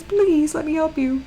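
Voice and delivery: high-pitched pleading imitation; Frenchie imitation. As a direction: beg to be allowed to help. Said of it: "He says".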